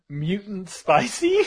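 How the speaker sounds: shouted through laughter; laughing. Real, not acted. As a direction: blurt it out mid-laugh. Cracking up at the name.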